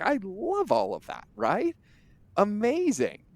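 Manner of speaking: way up high, gushing over the offer; mock gushing. Host mimicking a buyer's gushing praise, high mock delight. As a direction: oversell your delight, half-mocking.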